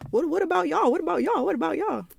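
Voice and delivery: high, mocking voice of defensive guys; mimicking a guy's whiny deflection; mocking dudes.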